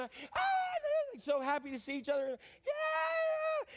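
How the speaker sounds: shouting high with delight; a mock ecstatic airport greeting; joyful reunion cry. Acting out happy lovers meeting at the airport, shouting with joy. Running to each other happily.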